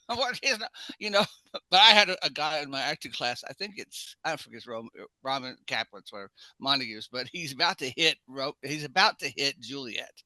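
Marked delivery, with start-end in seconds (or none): none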